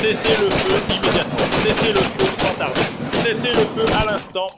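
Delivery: loud urgent command; shouting cease fire. Real, not acted. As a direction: yell it over the chaos.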